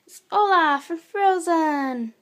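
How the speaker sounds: loud, high, slow reveal; excited reveal. Real, not acted. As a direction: announce it like a grand surprise. Big reveal of favourite toy.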